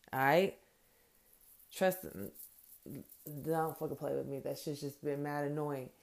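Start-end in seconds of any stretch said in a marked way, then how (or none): none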